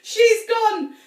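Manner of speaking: high, loud excited cry. A narrator's reading. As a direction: cry it out in triumph. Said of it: "cried Missus Cranky".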